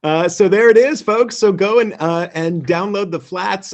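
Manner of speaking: buzzing with excitement to the audience; host hyped after the launch; excited. Real, not acted. Announces episode just went live.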